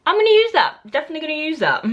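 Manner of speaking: thrilled to steal a new insult; delighted. Real, not acted. Vlogger gleefully claiming the insult Darth Dickwad, high excited squeal.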